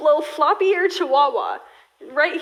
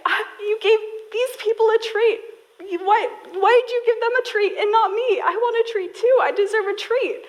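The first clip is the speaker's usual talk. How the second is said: much higher, whiny put-on puppy voice; whining dog voice; 8 semitones higher than usual. Dog whining at owners who fed others, higher whine. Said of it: "she'll whine, and she'll cry and say".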